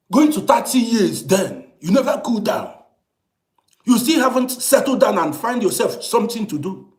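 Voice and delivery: high, loud exasperated telling-off; retold loud scolding. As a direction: scold him like an old friend who should know better. Retold scolding of old friend.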